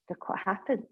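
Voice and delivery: normal talking voice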